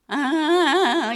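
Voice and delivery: very loud, drawn-out high wail; long loud wail. Retold or imitated. Child crying as long as possible, loud slow wail. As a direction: stretch the cry out, slowly. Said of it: "이러면".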